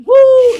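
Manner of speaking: very high, loud imitated whoop; mock woo. Brother mimicking sister's playful whoop, shrill woo. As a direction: squeal a silly woo.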